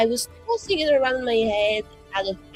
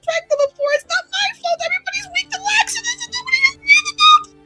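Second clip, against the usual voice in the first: high, loud, tearful babble; hyperventilating panic; 11 semitones higher, 5 dB louder, faster than usual. Acted. Confessing to Cyril about pranks, hyperventilating panic. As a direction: spiral upward into a squealing, breathless panic. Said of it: "you are at risk of hyperventilating".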